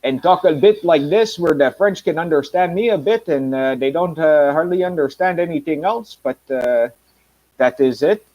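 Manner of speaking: put-on French-accented English. Shown as a demonstration. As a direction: talk with a bit of a French accent. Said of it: "make a bit of a French accent".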